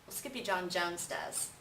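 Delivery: ordinary delivery, nothing marked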